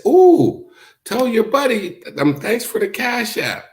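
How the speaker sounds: high, sing-song mocking girl voice; mock taunt. Mimicking the woman gloating to her rival, high and teasing. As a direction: tease her sweetly in a squeaky pitch.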